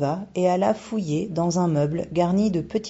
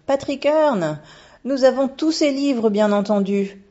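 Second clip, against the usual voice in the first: ravie, haut perché et plus fort; s'enflammer pour son auteur préféré; enthousiaste; 7 semitones higher, 5 dB louder than usual. A narrator's reading. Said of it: "s'exclama la bibliothécaire". (la bibliothécaire s'exclame, enthousiaste)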